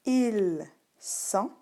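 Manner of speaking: "ils sont" slowly as il, son; word-by-word "ils sont"; silent S. Shown as a demonstration. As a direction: stretch "ils" with no final s, pause, then "sont". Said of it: "même si tu ne l'entends pas".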